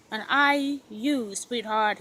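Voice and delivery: ordinary delivery, nothing marked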